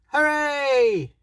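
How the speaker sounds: high and loud cheer; a big happy hooray from everyone; joyful shout. A narrator's reading. The animals cheer together, shouting hooray. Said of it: "shouted the little beaver".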